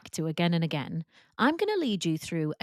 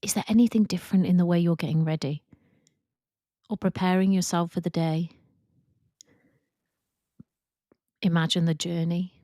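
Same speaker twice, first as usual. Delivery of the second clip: calm, even guided meditation voice with long pauses; guided visualization. Acted. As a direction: ease them into imagining, leaving space after each prompt.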